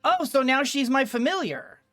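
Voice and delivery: normal talking voice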